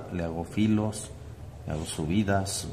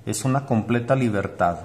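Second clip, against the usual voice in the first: énfasis enérgico; 5 semitones higher, 5 dB louder than usual. Real, not acted. Maestra enfatiza la libertad artística.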